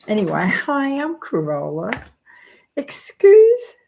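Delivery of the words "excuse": high squeal. Real, not acted. Apology for video glitch.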